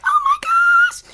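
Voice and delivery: squealed very high and loud; overjoyed squeal; delighted gush. Retold or imitated. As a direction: burst out like a thrilled grandma. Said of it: "I'm like".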